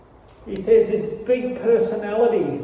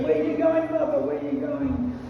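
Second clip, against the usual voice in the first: a hint of a pleading child, trailing off; little boy Krishna; 6 semitones higher than usual. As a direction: ask again, tugging at the dhoti. Young Krishna pleading with father.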